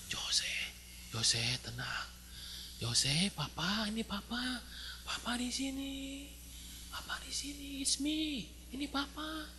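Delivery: put-on soft, slow whispered comfort to a child; soothing whisper. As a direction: hush the frightened son gently. Father soothing scared son. (ayah berbisik lembut menenangkan anak)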